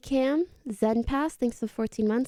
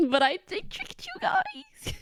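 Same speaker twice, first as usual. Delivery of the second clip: laughing. Real, not acted. Gloating after chat caught skip.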